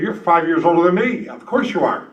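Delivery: ordinary delivery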